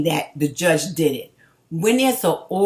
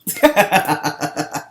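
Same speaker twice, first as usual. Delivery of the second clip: scornful laugh; 4 dB louder than usual. Real, not acted. Laughs after the call ends.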